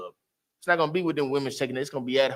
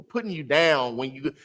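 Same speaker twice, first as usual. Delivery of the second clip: usual speaking voice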